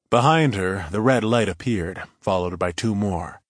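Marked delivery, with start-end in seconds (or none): none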